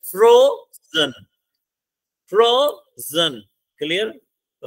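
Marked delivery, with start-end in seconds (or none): 0.1-1.1 frozen split in two, first syllable long, high and loud; frozen as two slow, separate beats, stress on the first; broken into syllables. Shown as a demonstration. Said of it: "आप बोल के देख लो". 2.3-3.4 frozen slowly split as फ्रो, pause, जन; broken into syllables. Shown as a demonstration. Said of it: "आप बोल के देख लो".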